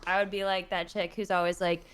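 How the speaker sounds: ordinary talk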